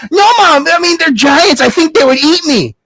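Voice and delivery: put-on high, pleading whine of a boy; protesting boy. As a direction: panicky mock protest to mom. Jack protests in fear.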